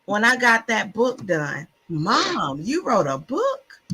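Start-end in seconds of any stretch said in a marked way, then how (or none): 1.9-3.8 drawn-out, swooping question of a retold kid; slow mock disbelief, pitch climbing; amazed child. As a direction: imitate children astonished at their mother. Children amazed by her book.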